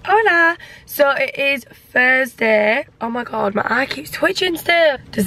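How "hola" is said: bright, loud hello; excited greeting. Real, not acted. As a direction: call out a cheery hello. Cheery greeting to camera.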